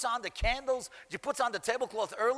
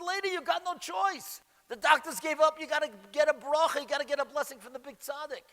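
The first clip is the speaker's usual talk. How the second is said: higher, louder, pressing plea; urgent insistence; 8 semitones higher, 5 dB louder than usual. Retold or imitated. Stranger pressing a despairing mother to get a blessing, urgent insistence. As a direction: urge it forcefully, pitched up high.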